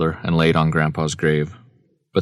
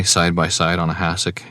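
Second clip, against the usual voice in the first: usual speaking voice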